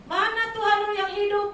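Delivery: loud, high jeering challenge; a scoffer taunting loudly; mocking taunt. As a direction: sneer it at a believer, loud and high. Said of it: "mocking unbeliever quote".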